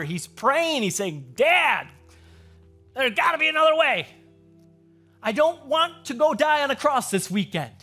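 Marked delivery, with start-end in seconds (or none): 1.4-7.7 high, loud plea to a father; agonized pleading. Acted. Jesus praying to his father in the garden, agonized raised-voice pleading. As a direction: cry out in protest, acting out the agony. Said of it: "we see him agonizing with his father".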